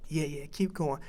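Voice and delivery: a soft aside, egging him on; hushed encouragement. Retold or imitated. Coach quietly urging Parsons to continue, hushed. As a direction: keep him going under your breath.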